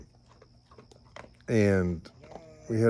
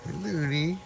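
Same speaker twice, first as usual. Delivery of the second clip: talking to a dog; 4 semitones higher, 15 dB quieter than usual. Real, not acted.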